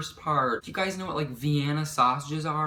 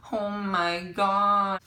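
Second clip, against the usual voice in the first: sounding like mom; 7 semitones higher, much slower than usual. Retold or imitated. To herself while stoned at creepy videos, sounding like mom. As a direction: stretch each word out slowly, climbing in pitch. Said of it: "It sounds like my mom".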